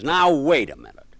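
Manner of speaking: loud angry outburst from the lady on the phone. Retold or imitated. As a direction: snap "wait a minute" at the caller, loud. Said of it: "Now, this time she's angry".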